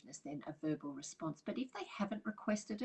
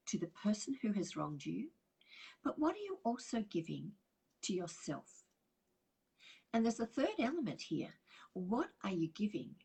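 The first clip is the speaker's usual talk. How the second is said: normal talking voice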